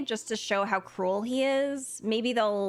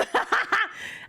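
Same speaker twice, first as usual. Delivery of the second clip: high laugh; 14 semitones higher than usual. Real, not acted. Laughing at chat mishap.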